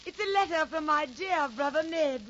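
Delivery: loud, projected stage declamation; theatrical stage actress. Acted. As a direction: play it big for the back row. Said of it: "One of the ladies says".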